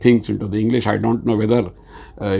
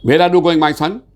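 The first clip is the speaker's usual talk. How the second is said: raised, louder lilting question to a son; high, loud, modulated delivery; 6 semitones higher, 4 dB louder than usual. Shown as a demonstration. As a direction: lift the voice high on "Where are you going", calling out to your son. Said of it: "Just I am talking to my son".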